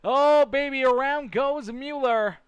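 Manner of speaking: high, loud shouted race call; excited live play-by-play. Real, not acted. Race commentator calling a crash live to the crowd, excited shouting. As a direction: shout the crash as it happens, pitch soaring.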